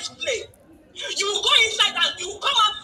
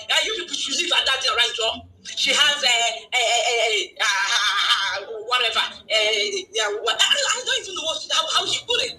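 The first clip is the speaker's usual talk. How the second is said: mocking quote; 7 semitones lower than usual. Mocking quote of a cabinet member.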